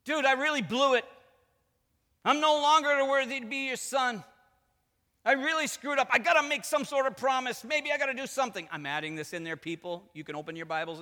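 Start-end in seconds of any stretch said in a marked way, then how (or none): 0.0-8.7 high, loud, frantic confession; loud, pitched-up panicky son; pleading prodigal son. Acted. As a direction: blurt out a desperate apology. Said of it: "the son's still on his program".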